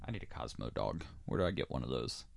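ordinary talk

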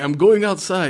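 bold declaration. Retold or imitated. Character announces a bold move.

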